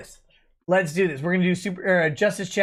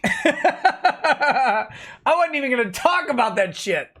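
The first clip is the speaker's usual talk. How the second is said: laughing; 4 semitones higher than usual. Real, not acted. Laughs at own drunk rambling.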